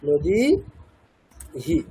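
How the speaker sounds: very slow "নদীহি", long "দী", separate short "হি"; short final hi. Shown as a demonstration. Teacher answering how it is pronounced, slow "নদীহি" with separate short হি. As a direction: stretch "দী", pause, then brief "হি". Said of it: "actually হ্রস্ব হবে".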